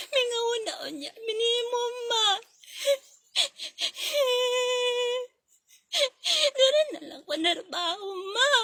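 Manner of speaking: put-on high, tearful child's plea; a small kid crying, high and pleading. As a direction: beg mama through sobs not to go. Child begging mother not to leave.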